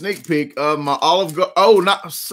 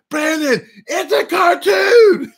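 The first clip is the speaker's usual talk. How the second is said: high, slow, exasperated mother's voice; imitating mom; 14 semitones higher, 4 dB louder, slower than usual. Mother telling her son it's only a cartoon, high and exasperated. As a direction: mom calling out over him, much higher. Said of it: "my mom was like".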